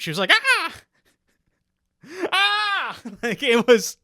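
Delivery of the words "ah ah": high, loud shriek of fright; woman jumping at a sudden fan; startled scream. Retold or imitated. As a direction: scream as if jolted awake. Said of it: "And she screamed".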